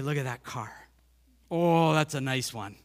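lightly put-on old-timer car fan; a hint of a proud car owner showing off; mock car admirer. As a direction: admire the car in a mild folksy voice. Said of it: "I don't know where that came from".

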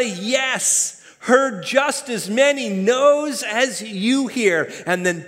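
high, loud, building sermon delivery; full-force preaching. Real, not acted. Preacher telling the church the brave were scared too, impassioned. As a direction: drive each parallel phrase home with force.

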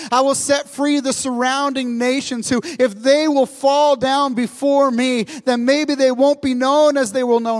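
full-voiced sermon build; preaching at full force. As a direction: proclaim it like a revival preacher. Preaching God's promise of freedom.

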